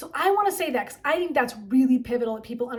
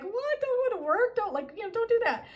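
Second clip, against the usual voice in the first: much higher, urgent mock warning; alarmed inner voice; 11 semitones higher than usual. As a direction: plead with her high and fast not to go to work. Intuition urgently telling her to stay home.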